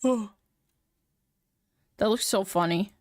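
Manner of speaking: usual speaking voice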